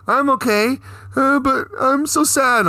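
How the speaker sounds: high, drawn-out weepy whine; hammed-up sad, high voice; mock tearful. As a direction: imitate a woman crying as she talks. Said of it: "like, crying or whatever".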